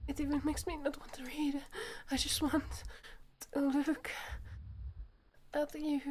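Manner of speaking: high, whispery, halting voice; overwhelmed, breathless Yuri. Acted. Yuri confessing to Ryuki, breathless and overwhelmed. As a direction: breathe it out in broken phrases, unravelling.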